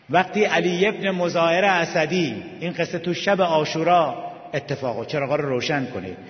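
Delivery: ordinary talk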